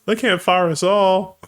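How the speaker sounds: high, slow put-on voice; mock naive worker. Union worker sure the jobs are safe, high mock-confident.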